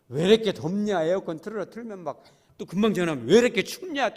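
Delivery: loud grumbling caller, hot then cold; loud peevish grumble about the temperature; grumpy complaint. Retold or imitated. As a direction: gripe like a never-satisfied congregant.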